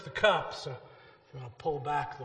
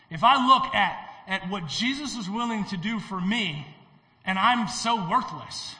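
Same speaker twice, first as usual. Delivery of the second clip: ordinary delivery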